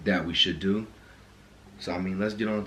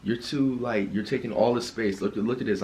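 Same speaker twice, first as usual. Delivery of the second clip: normal talking voice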